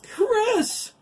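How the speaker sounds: very high, loud scream of a name. Acted. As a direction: scream up in horror as he falls. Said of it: "Virginia cried from below".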